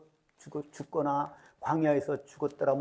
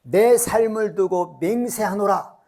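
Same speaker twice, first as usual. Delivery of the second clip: louder and slow, weighty solemn vow; God swearing an oath; 5 dB louder, slower than usual. Acted. Preacher as God swearing against Israel, slow and louder. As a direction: pronounce the oath slowly like a judge.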